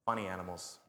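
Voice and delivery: normal talking voice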